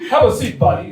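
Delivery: comic Middle Eastern take on a TV host; Middle East Chris Hansen. Acted. TV host confronting a guest, hammed-up Middle Eastern voice. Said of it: "my best impression, like, Middle East Chris Hansen".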